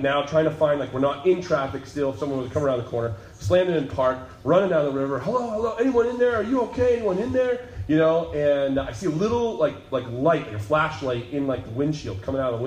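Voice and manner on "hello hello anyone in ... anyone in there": calling out. Retold or imitated. Calling down to wrecked car.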